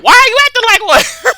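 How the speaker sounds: high, loud comeback breaking into laughter; exasperated yelled retort. Retold or imitated. Speaker snapping back at a childish adult, high exasperated yell.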